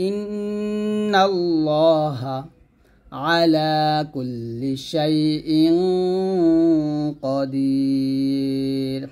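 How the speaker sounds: slow tajweed chant with long final madd; Quran recitation. Shown as a demonstration. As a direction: draw the last word out. Recites verse ending slowly. (ধীর তাজবীদ তিলাওয়াত)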